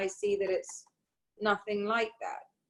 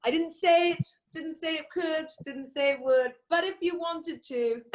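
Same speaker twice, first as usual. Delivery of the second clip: mock president; 8 semitones higher than usual. Co-host mocking the leader's excuse, high mock voice. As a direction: send up the backpedaling leader.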